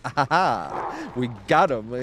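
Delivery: slow, hushed scheming laugh; sly gloating chuckle. Retold or imitated. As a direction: savor the win like a plotting rival. Defeated composers gloating.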